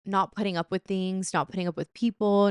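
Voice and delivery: ordinary delivery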